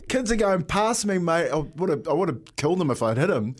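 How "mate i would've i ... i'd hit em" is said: normal talking voice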